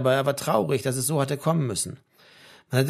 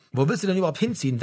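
fast, higher question; quick topic change; 6 semitones higher, faster than usual. A narrator's reading. Mother quickly changing the subject, brisk. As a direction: hurry the question in. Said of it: "wechselte seine Mutter schnell das Thema".

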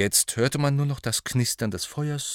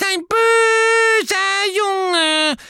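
very high, slow, loud angry squeal; Dobby furious at Malfoy; angry squeak; 8 dB louder, much slower than usual. A narrator's reading. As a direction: squeal it in fury like a small elf. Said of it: "quiekte Dobby zornig".

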